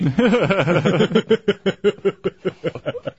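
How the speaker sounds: long burst of real laughter. Real, not acted. Host watching cohosts scuffle, long helpless laugh. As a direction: laugh it out at length.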